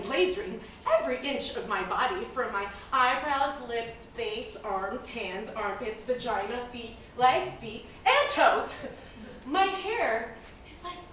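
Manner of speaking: ordinary talk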